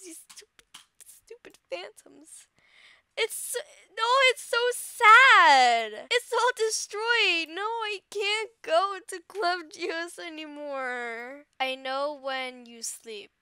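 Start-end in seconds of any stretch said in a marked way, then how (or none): 0.0-2.5 bitter whisper. Acted. Cursing the Phantoms. 3.2-11.4 mock sobbing. Mock mourning.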